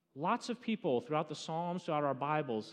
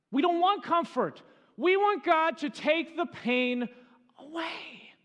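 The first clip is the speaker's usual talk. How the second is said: much higher, louder, slow plea, fading to a breath on away; impassioned cry; 14 semitones higher, 8 dB louder, slower than usual. Acted. Pastor voicing the hurting believer's plea, impassioned. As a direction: cry out the longing for relief. Preacher cries out the longing.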